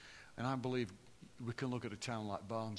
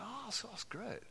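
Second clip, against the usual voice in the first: lifted, much higher and slowed, breathy-free polite delight; a touch of fake enthusiasm, higher and slower; mock impressed; 10 semitones higher, 6 dB quieter, slower than usual. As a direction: act falsely charmed by Sheffield. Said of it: "people were really".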